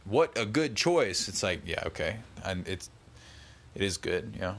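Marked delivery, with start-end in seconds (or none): 0.0-1.3 high, slow, impressed voice; mock-amazed praise of the local beer; impressed coworkers. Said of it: "they were like".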